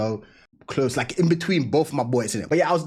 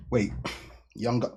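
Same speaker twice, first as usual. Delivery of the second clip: ordinary talk, nothing marked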